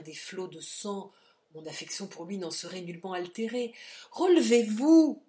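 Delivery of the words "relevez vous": loud, high command; shouted order. A narrator's reading. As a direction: order him up with force. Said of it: "répéta-t-il". (ordre crié, fort)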